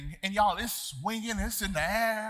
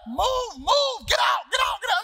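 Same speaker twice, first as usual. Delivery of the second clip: high loud shouted commands; mock shout of lift attendants ordering him off; imitating yelling workers; 6 dB louder than usual. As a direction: bark it like staff hurrying you off. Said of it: "they're just screaming at me".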